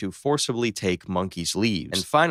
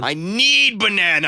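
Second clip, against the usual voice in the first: loud high pleading shout; desperate shout; 9 semitones higher, 6 dB louder than usual. Acted. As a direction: beg for it at full volume.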